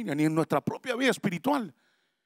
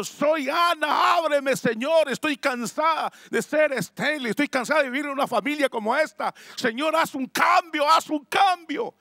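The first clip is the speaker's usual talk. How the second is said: desperate cry; 10 semitones higher, 5 dB louder than usual. Acted. Barren Ana begs God for change, desperate shout. Ana's inner prayer cried out.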